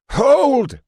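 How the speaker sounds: high, loud commanding shout; crying out. A narrator's reading. Stout man stopping Molly from smashing the hive, shouting. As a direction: stop her before she smashes it. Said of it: "cried the stout man".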